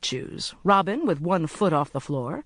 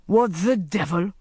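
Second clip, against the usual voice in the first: mildly startled exclamation; startled cry. A narrator's reading. Sheikh hearing voices outside, crying out. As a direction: cry out, taken aback. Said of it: "cried the sheikh".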